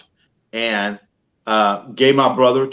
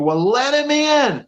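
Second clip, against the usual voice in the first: retold scream; 12 semitones higher, slower than usual. Mother calling to the boys about Santa, high imitated scream. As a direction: imitate a mother hollering, pitched way up and stretched. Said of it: "I could hear her screaming".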